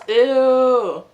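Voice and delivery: loud, high disgusted "ew". Real, not acted. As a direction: recoil in disgust. Sudden disgust while eating.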